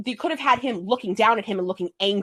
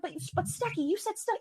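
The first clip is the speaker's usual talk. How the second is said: high, soft whiny fan voice; lightly mocking nagging fan; 10 semitones higher, 11 dB quieter than usual. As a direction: mimic a pestering fan, much higher. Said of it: "people are like".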